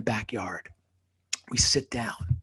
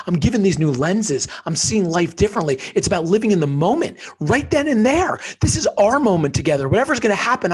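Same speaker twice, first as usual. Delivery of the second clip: higher and louder, pouring it out; passionate, building; 6 semitones higher, 7 dB louder than usual. Real, not acted. To the host, swelling with passion about the moment. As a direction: preach it with rising fervor. Impassioned lesson about living now.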